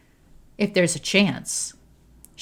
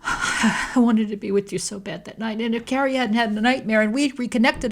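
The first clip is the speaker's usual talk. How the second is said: higher, pleading through tears; a tearful higher plea; sobbing plea; 5 semitones higher than usual. A narrator's reading. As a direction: beg her through the sobs.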